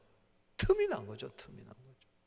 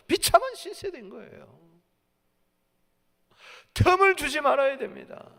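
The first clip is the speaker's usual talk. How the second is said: loud, raised pulpit cry; full-force preaching; 8 semitones higher, 9 dB louder than usual. Real, not acted. Preacher warns of devil attacking through gaps, full-force preaching. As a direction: proclaim each warning at full voice.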